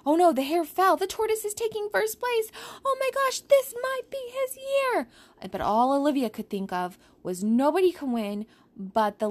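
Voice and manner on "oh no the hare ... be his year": high, breathless race call; excited commentary. Acted. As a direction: call the finish like a thrilled commentator.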